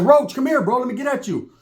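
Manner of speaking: high, hurried beckoning; mock urgent call. Pulling Roach aside after spotting something, urgent high call. Calls his friend over urgently.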